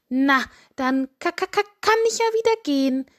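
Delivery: high clucked stammer, then a quick finish; stuttering hen. Acted. Hen stutters she can leave.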